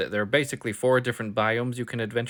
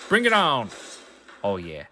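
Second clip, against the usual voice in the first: pumped up; 4 dB louder than usual. Real, not acted. Ready to fight.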